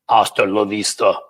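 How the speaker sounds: put-on lightly done German accent, Arnold-style; a touch of a mock German accent. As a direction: imitate an action star's catchphrase with a hint of German. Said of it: "I said it in a German accent".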